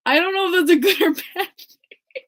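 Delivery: high giggly aside; laughing through the words; laughing embarrassed. Real, not acted. Fan laughing at her own awkward moment.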